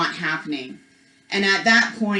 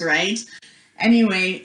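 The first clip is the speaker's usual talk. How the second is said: ordinary delivery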